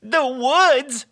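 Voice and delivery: high, loud, drawn-out dismay; horrified storybook wail; alarmed cry. A narrator's reading. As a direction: cry it out in hammed-up dread. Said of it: "cried Todd".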